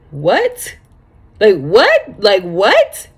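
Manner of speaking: blurting out in disbelief at the news; shocked disbelief. Retold or imitated. Retelling brother's news of death.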